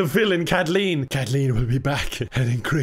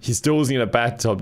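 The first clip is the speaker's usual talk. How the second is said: ordinary talk, nothing marked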